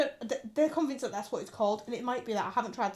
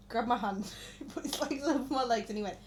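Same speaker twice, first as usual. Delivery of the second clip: usual speaking voice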